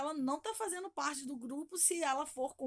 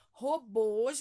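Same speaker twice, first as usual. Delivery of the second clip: Robôs with a loud final bôs; stress on last syllable; 7 dB louder than usual. Shown as a demonstration. Teacher helping pupils find the strong syllable, Robôs stressed on bôs. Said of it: "você vai sublinhar o bois".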